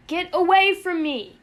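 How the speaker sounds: loud, high desperate cry; pleading. A narrator's reading. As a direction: beg him at full voice. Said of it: "Yossarian answered, pleading with him now".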